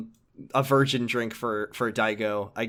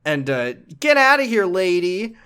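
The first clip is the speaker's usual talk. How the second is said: put-on bratty kid; 8 semitones higher, 5 dB louder than usual. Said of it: "he's just like".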